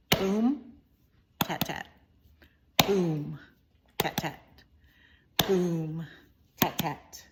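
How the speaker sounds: slow boom, short tats; drumbeat imitation. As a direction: voice the drum rhythm slowly. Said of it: "beat the drum slowly".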